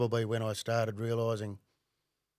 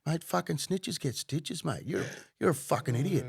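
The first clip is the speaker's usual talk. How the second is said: retold heated telling-off, higher and louder in bursts; ripping into him; 4 semitones higher, 4 dB louder than usual. Ex-undercover worker scolding an informant, ripping into him. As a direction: lay into the caller, acting out the rant. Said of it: "I'm just ripping into him".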